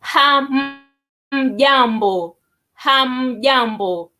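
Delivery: Hamjambo said slowly, last one drawn out; slow demo. Shown as a demonstration. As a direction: model hamjambo for a group. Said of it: "you will say".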